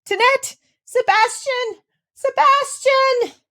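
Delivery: very high, loud calls, last name slowly drawn; calling loudly. A narrator's reading. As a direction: summon the servants in horror. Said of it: "she found voice to call loudly".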